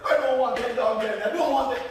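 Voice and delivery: bratty protest. Acted. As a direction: whine loudly against the dogs. Buddy rejects the dogs.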